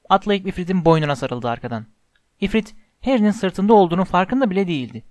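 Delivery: usual speaking voice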